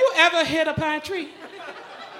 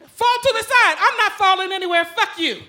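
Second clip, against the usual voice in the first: high yelled back-and-forth, laughing; panicked yelling; 8 semitones higher than usual. Retold or imitated. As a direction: yell it out, losing control. Friends yell, she yells back.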